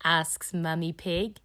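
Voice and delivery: ordinary delivery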